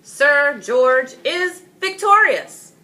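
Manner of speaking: loud, high, slow proclamation; a king naming the victor to the crowd; royal declaration. Acted. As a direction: announce the winner grandly. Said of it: "the king announced".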